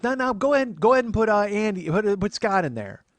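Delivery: high, loud imitated aunt; bossy aunt. Aunt telling nephew whom to add, bossy aunt voice. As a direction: boss him around as the aunt.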